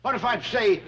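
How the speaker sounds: calling out. Acted. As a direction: call for their attention. Jed calls the girls again.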